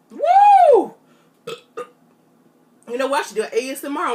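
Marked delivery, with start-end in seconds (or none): 0.1-0.9 loud, high whoop of relief; triumphant whoop. Real, not acted. Vlogger celebrating her big burp, loud high whoop. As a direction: whoop as the burp finally breaks free.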